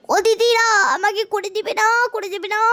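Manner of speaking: high-pitched pleading customer. Acted. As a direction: beg in a high voice.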